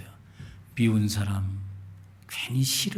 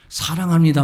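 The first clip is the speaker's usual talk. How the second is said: loud, mock-seductive declaration; 3 semitones higher, 8 dB louder than usual. Preacher mocking a man's sweet talk to a woman. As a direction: sweep her off her feet. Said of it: "열 번 말하면 안 돼".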